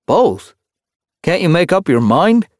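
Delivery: high, loud, scornful question; disdainful. A narrator's reading. Kittypet scoffs at a part-time warrior, disdainful. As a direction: ask it with incredulous disdain. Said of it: "asked with a disdainful sniff".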